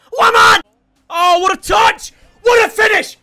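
high, loud celebratory yell; goal scream. Real, not acted. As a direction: roar the player's name.

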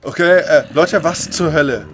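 shocked exclamation. Real, not acted. Shocked after monster jump.

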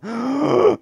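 high startled gasp; sharp gasp. Real, not acted. Player suddenly spotting a cow, startled gasp. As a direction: suck in a sudden shocked breath.

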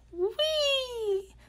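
playful whee, high and soft; gleeful whee. Acted. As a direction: let it fly up like sliding down a slide. Caterpillar sliding across table.

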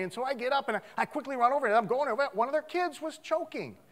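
normal talking voice

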